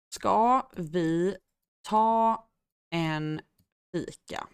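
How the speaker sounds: slow, separated words with clear pauses; measured teacher's dictation. Shown as a demonstration. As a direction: say it one word at a time for learners. Teacher repeats phrase word by word.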